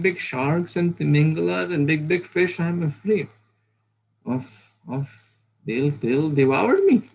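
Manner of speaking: high, loud, hammed-up frightened fish voice; frightened little fish. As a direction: plead like a tiny fish afraid of sharks. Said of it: "The Lord here is saying".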